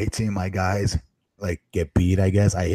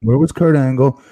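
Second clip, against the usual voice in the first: louder repeat; 3 semitones higher, 9 dB louder than usual. Real, not acted. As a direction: repeat it louder, stress Kurt. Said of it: "What's that?".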